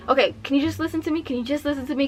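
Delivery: fast, pleading retold interruption; impatient interruption. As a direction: rush to cut the officer off. Acted cutting the officer off.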